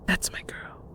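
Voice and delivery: whispered, quiet; a mother ending the lesson in a whisper; soft and controlling. Acted. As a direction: close it gently but firmly. Mother closing the lesson softly.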